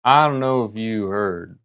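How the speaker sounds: slow, drawn-out cowboy drawl; a lightly put-on western drawl; cowboy accent. Streamer tries a cowboy fact intro, slow cowboy drawl. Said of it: "Cowboy accent".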